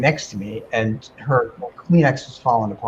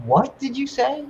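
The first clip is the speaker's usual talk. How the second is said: startled question; 11 semitones higher, 3 dB quieter than usual. Retold or imitated. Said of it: "and I said out loud".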